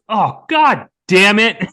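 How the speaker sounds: loud, drawn-out, high curse; frustrated curse. Retold or imitated. Staff member cursing at the fake turtle, slow loud exasperated curse.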